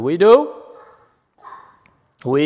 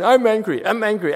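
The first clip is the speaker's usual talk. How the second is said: higher-pitched mock chanting of a complaint; mock mantra; 7 semitones higher than usual. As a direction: rattle it off as an empty refrain. Mimicking repeating it as only action.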